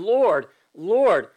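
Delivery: both words "Lord" punched loud, each swooping down; desperate, emphatic. Acted. Desperate people calling on Jesus, "Lord" emphatic both times. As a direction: cry out "Lord" twice with heavy emphasis. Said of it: "They're emphatically saying".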